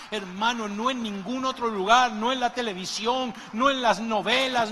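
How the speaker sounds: loud, high-pitched shouted preaching; preaching at full force. Acted. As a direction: build to a loud, high climax for the church. Clímax de predicación. (predicación a todo pulmón, alta y fuerte)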